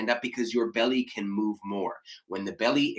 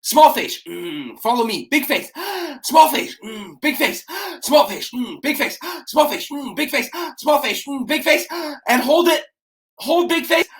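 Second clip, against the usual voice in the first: high, loud gasps and hums, much higher; energetic face drill; 11 semitones higher, 5 dB louder than usual. Shown as a demonstration. Coach calling out the big face, small face drill, high and energetic. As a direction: bounce between gasp and hum, full energy. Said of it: "back and forth from big face and small face".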